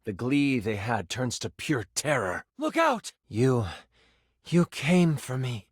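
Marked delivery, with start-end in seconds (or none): 2.6-3.1 sudden high-pitched warning; alarmed, pitched-up call; urgent warning cry. Acted. Character cries a warning in story. 3.3-5.7 rescued partner. Acted. Rescued partner speaks to the hero.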